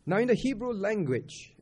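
ordinary talk

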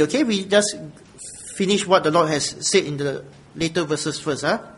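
ordinary talk

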